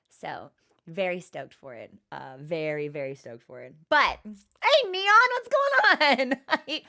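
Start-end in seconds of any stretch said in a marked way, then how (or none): 0.1-1.9 breathy whispered aside, unvoiced. Real, not acted. 2.1-3.7 very soft, almost whispered, and much quieter; hushed repeat. Real, not acted.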